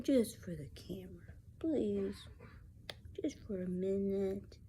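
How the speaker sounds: breathy whisper, begging softly; whispered pleading. Real, not acted. Begging her kitten to pose for the camera in a soft whisper.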